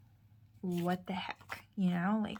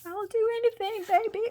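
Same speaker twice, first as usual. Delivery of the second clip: much higher, louder desperate plea; desperate mom; 13 semitones higher, 6 dB louder than usual. Retold or imitated. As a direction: promise anything to your hurting baby. Mom answering her injured child.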